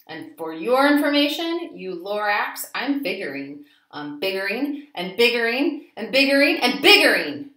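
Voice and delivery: loud furious yelling at the Lorax. A narrator's reading. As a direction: shout him down, you've had enough. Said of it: "I yelled at the Lorax".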